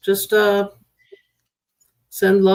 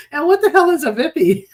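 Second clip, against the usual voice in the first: asked through a laugh, higher; laughing question; 9 semitones higher than usual. Real, not acted. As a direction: giggle while asking.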